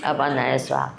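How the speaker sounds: low, murmured ritual formula; mock food-offering prayer. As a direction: pretend to offer a prayer over something absurd. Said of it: "ऐसे कर सकते हैं cigarette को करके".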